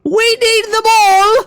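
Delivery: high, drawn-out manic screech; voices in the head. Pretend. Voices in gamer's head demand Bertie Box beans, manic screech. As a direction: screech it like a crazed craving. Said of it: "hear the voices in my head acting up".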